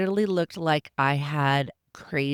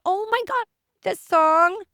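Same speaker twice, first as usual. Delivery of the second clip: excited squeal; 13 semitones higher than usual. Retold or imitated. Excited at [name] song.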